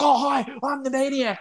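high, bright eager greeting; eager Maniac. Retold or imitated. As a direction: play the overeager wrestler. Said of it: "the Maniac's like".

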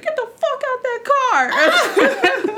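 very slow, high mock yell; drawn-out mock yell. The woman yelling at the car, slow and laughing. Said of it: "She was like".

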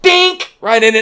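high, loud yelp of sudden pain. Real, not acted. Telling friends about the dart, a high loud yelp. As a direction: yelp as the dart hits the ankle bone. Dart hits his ankle.

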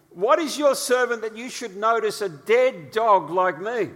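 a terrified servant crying out to the king; fearful plea. Acted. Mephibosheth's fearful words to David.

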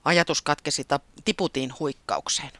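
ordinary delivery

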